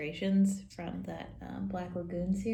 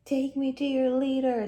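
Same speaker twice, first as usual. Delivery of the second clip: creepy alien; 5 semitones higher, 5 dB louder than usual. Acted. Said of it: "says this creepy alien thing".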